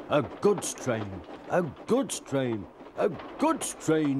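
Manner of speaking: slow, indignant grumble, climbing in pitch; grumbling. A narrator's reading. Gordon pulling dirty trucks, very cross grumbling. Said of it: "he grumbled".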